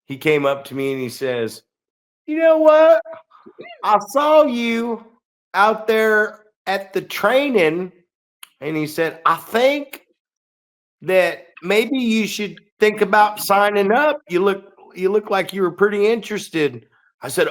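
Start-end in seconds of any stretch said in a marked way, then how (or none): 2.2-7.9 high put-on voice of a goofy guy; squeaky, slow mock voice of the wrestler; imitating the guy. Retelling the guy who wanted him to sign up, high and slow. 9.2-16.9 high put-on voice of a goofy guy; imitating the guy.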